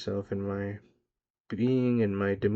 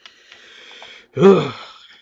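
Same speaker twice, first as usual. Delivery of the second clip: a big, loud exhale of release; a heavy sigh that comes out loud; 7 semitones higher, 18 dB louder than usual. Real, not acted. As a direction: let out a long, loud sigh. Overwhelmed after retelling his effort.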